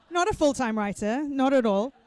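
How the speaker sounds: loud teasing exclamation. Real, not acted. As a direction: tease him, loud and bright. Host teasing after revelation.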